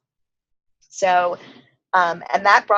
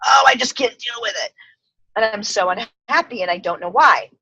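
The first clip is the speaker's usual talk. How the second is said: hammed-up miserable complaint; exasperated wail; 3 semitones higher, 5 dB louder than usual. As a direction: act out an overwhelmed inner voice. Voices her unhappy inner self.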